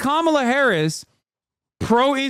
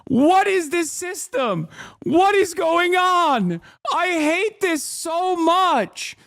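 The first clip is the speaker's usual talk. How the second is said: slow, drawn-out disbelief, pitched up; exasperated disbelief; 6 semitones higher, slower than usual. Real, not acted. Streamer baffled by undecided voters, slow and exasperated. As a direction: stretch every word out in disbelief.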